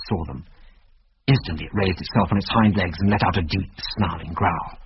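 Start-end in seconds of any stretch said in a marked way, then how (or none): none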